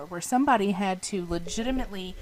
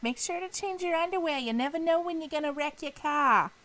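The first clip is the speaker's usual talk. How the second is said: Jersey aunt; 6 semitones higher than usual. Retold or imitated. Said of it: "an aunt from Jersey".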